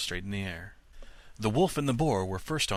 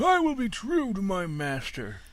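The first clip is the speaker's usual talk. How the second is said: higher firm refusal; Sultan refusing; 11 semitones higher, 4 dB louder than usual. A narrator's reading. Old dog refuses the wolf, higher and firm. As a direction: refuse the wolf flatly, pitched up. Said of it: "said the Sultan".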